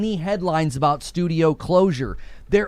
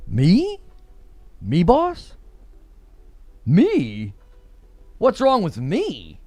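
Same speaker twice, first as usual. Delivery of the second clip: mock innocent; slower than usual. Echoing a viewer's question in mock innocence. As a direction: point to yourself, all innocence.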